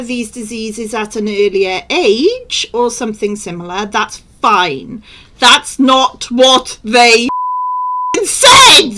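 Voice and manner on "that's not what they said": exasperated outburst. Real, not acted. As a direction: hold back, then explode on "said". Exasperated rant about the report.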